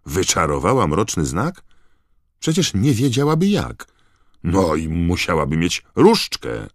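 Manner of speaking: loud, high, astonished outburst; incredulous protest. Acted. Bagman refusing to believe Mrużka cast it, incredulous protest. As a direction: let the pitch soar in disbelief.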